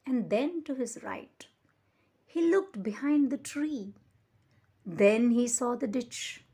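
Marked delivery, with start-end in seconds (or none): none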